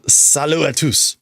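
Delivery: mock aggressive French hello; aggressive French greeting. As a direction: bark out "Salut à tous" like a pushy Frenchman. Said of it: "awfully aggressive sounding".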